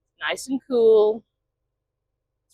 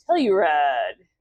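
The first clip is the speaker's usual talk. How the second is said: usual speaking voice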